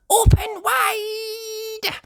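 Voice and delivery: very high, slow singsong sheep voice; high drawn-out little sheep; Susie Sheep. Acted. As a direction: coax like a nurse. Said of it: "says Susie".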